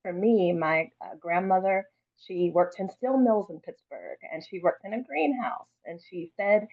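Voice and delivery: usual speaking voice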